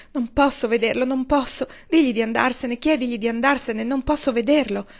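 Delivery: wringing her hands, begging him away; panicked pleading. Acted. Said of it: "prese a torcersi le mani". (supplica disperata, più acuta e forte)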